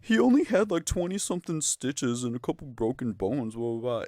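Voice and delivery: high squeaky mock of the girl brushing it off; shrill dismissive impression of her post; mocking girl's voice. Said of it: "the girl's like".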